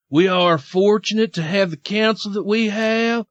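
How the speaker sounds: Southern pious read, slowing toward the end; mock Southern accent. Host quoting Chrisley on the good Lord, mock Southern accent.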